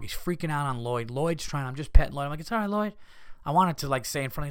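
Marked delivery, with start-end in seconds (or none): 2.4-2.9 higher, acted reassurance; soothing the dog. Owner petting frightened Lloyd, reassuring. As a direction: comfort a pet in a lifted voice.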